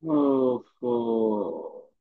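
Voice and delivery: low, drawn-out exasperated groan; teacher's weary, stretched-out "oh no". Real, not acted. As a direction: let out a long, low sigh of dismay. Students fail to answer teacher.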